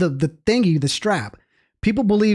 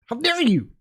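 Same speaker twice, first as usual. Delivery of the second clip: mock outrage; 5 semitones higher than usual. Repeats mock critic's outrage.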